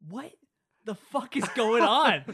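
slow, loud, drawn-out outburst; loud disbelief. Real, not acted. Reacting to the movie's fake smiles, loud disbelief. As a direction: let the disbelief explode.

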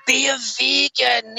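high, drawn-out ghostly wail. Pretend. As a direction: moan it slowly like a spook. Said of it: "they're gonna hear".

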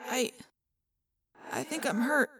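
soft, halting admission; long pauses, barely getting it out; weak and hurting. Acted. Badly wounded friend after battle, soft and faltering.

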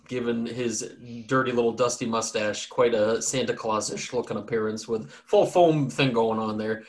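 ordinary delivery

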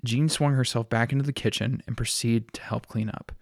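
ordinary delivery

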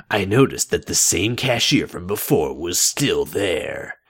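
usual speaking voice

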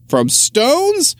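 very high-pitched disbelief; incredulous question. A narrator's reading. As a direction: question it as if it were absurd, pitch way up. Mayor incredulous at stone soup.

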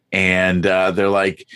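ordinary talk, nothing marked

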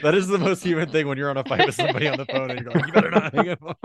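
high, loud, fast, laughing through it; cracking up while imitating a phone fight; laughing imitation. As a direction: giggle out a mock phone threat.

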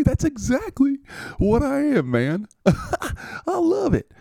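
cheerful receiver thanking the podcast host; goofy high dude voice. Acted. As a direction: play a giddy, friendly jock. C Ridley voiced as a guest.

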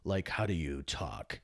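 deep trailer voice; movie announcer voice. Shown as a demonstration. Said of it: "I should read that like I'm a movie".

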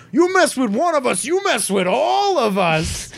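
high, stretched-out rallying cry; hammed-up crowd heckle, drawing out the end; mock defiant yell. New Yorker threatening Green Goblin, high mock-defiant cry.

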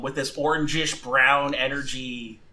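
ordinary talk, nothing marked

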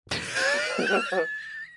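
shrieking burst of laughter. Real, not acted. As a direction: squeal with laughter. Laughs at own joke.

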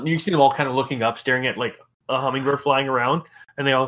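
ordinary delivery

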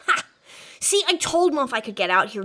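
ordinary talk, nothing marked

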